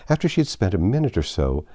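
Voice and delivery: plain reading voice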